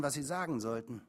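usual speaking voice

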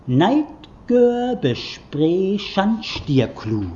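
slow, loud, declaimed sound poetry; sounding out words. Shown as a demonstration. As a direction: boom out each odd word, slowly. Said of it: "Das geht so". (laut und gedehnt deklamierte Lautpoesie)

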